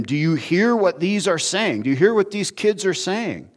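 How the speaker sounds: a touch of outraged priest; indignant priests. Retold or imitated. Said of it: "they said to him".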